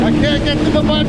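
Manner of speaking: frustrated. Real, not acted. Struggling to reach a button.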